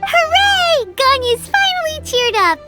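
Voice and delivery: very high, squeaky celebration; gleeful cheer. Acted. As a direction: cheer for your friend in a tiny high voice. Companion cheers friend's recovered spirits.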